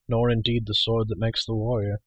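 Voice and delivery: plain reading voice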